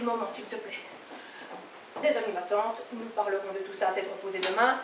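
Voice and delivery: low, soft, weary plea; calm her down in a hushed low voice; placating mother. Acted. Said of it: "Je lui dis". (voix basse et douce, pour apaiser sa mère)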